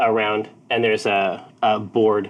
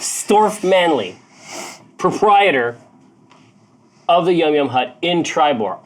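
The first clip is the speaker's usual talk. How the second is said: self-important introduction; 5 semitones higher, 3 dB louder than usual. Acted. Proprietor presenting himself to the challenger, self-important. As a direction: announce name and title with great importance.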